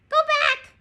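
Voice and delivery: very high, loud parrot voice; parrot squawk. A narrator's reading. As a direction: screech it like a warning bird. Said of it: "squawked Polly".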